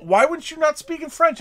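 high, pressing, baffled question; exasperated disbelief. Real, not acted. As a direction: badger them in a high voice. Baffled, badgering the reluctant speaker.

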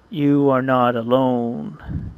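low, slow, drawn-out telepathic voice; mysterious mentor. Acted. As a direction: intone it slowly and low, from afar.

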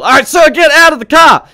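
high, loud shout at the driver; police command shout. Pretend. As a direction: bark the order to get out. Officer ordering suspect from car.

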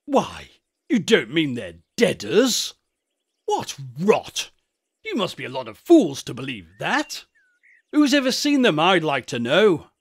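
schoolboy scoffing, rising to a loud challenge; bitterly dismissive boy; scornful and bitter. Acted. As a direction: pour scorn on the tale. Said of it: "Stanley was scornful and bitter".

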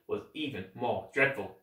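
usual speaking voice

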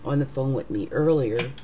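ordinary talk, nothing marked

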